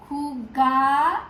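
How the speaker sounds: "Jugar" split into two drawn-out syllables; teacher's slow, separated "Jugar". Shown as a demonstration. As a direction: say "Jugar" slowly in parts, ending on a rise. Teacher breaks word into parts.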